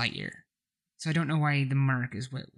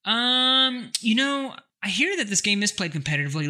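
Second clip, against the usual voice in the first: ordinary talk, nothing marked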